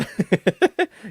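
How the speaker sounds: bright high burst of laughter. Real, not acted. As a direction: laugh at your own pirate bit. Laughs after pirate line.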